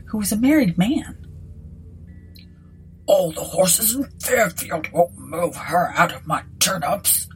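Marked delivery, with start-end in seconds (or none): 3.1-7.3 mildly grumpy country landlord; put-upon village innkeeper; frowning landlord. A narrator's reading. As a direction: grumble with a frown in a rustic voice. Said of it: "frowning at her".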